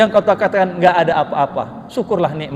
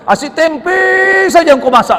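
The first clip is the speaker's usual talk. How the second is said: retold husband's grumble, pitched up on a long-drawn tempe; suami mengomel; 7 semitones higher, 6 dB louder than usual. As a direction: imitate an exasperated husband complaining, louder at the end. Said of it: "naik darah tingginya". (menirukan suami yang kesal)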